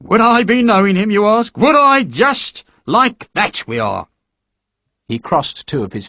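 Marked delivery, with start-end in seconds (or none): 0.0-4.1 higher, lightly voiced hearty sergeant; jovial recruiting sergeant. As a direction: charm the lad into signing up. Sergeant boasting.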